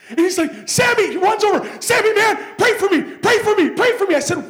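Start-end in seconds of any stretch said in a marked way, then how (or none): 0.7-4.1 much higher, loud, urgent begging; high loud desperate cry; frantic plea. Retold or imitated. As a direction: beg for prayer in a panic. Panicked friend begging for prayer.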